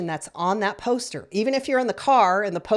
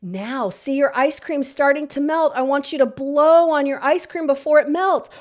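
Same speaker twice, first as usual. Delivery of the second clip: put-on high, louder sing-song voice for a small child; playful parent guiding a deep breath; coaching a child to breathe; 7 semitones higher, 5 dB louder than usual. Shown as a demonstration. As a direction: coax the child through the breath like a game. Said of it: "take three deep breaths together like this".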